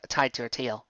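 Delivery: plain reading voice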